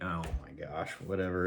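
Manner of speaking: very soft, low aside; dismissive mutter. Retold or imitated. Man reacting to news of people lurking, dismissive mutter. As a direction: mutter it under the breath, fed up.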